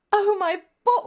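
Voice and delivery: high, loud mock-British cry; accidental British accent. Reader voices heroine and accidentally goes British, high British accent. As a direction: slip into a British accent, exclaiming loudly. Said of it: "I accidentally went British".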